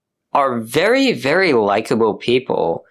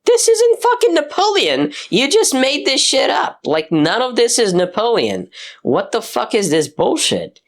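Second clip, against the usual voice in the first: outraged audience; 11 semitones higher than usual. Retold or imitated. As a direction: shout it like fed-up fans. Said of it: "people are like".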